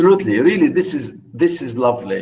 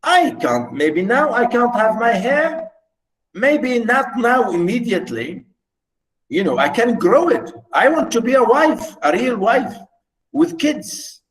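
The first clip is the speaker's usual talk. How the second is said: a hint of pleading wife, higher; longing wife; 6 semitones higher than usual. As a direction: yearn for a real family. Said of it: "She said".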